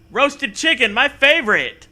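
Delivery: gleeful excitement. Acted. Gleeful quoted narrator.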